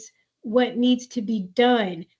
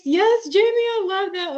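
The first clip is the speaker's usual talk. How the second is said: delighted; 10 semitones higher than usual. Real, not acted. Host delighted by parent's phrase.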